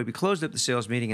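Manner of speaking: ordinary talk, nothing marked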